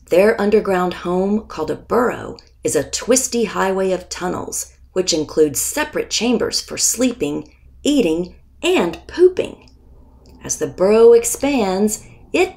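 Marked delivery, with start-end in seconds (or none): none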